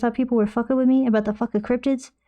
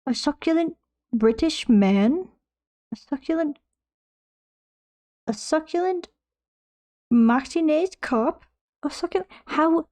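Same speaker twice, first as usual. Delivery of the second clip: put-on British accent; 5 semitones higher than usual. Streamer slipping into a mock British accent. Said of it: "I don't know why I'm fucking British now".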